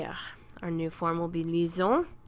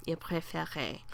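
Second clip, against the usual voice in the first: whispered softly as an echo; 6 dB quieter than usual. Shown as a demonstration. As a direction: breathe it out as a quiet whisper. Teacher repeats the form.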